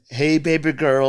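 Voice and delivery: slow, hammed-up Indian accent on "Hey, baby girl"; imitating Indian accent twang. Producer mimics singer's English, slow with an Indian accent twang. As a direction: sing the title slowly with a light Indian accent twang. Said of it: "it had a little bit of that twang".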